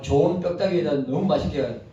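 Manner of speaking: normal talking voice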